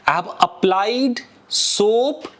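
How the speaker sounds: slow, careful English model sentence. Shown as a demonstration. Teacher modeling an English phrase slowly for students. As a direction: spell it out slowly for learners. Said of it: "लगाने को मैं क्या बोलूंगा".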